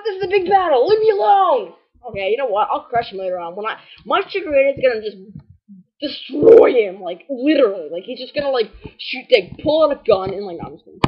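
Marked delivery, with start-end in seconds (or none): 0.0-1.7 higher, louder battle yell; mock battle cry. Voicing battling wild Pokemon, hammed-up yell. 6.0-7.0 slow, loud stretched destroy; drawn out threat. Real, not acted. Boasting Chikorita's attack to viewers, slow and loud. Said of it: "hyping Chikorita's attack".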